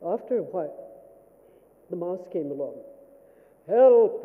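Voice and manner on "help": loud roar for help; big, booming storybook lion. Acted. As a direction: bellow it like a trapped lion. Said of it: "roared the lion".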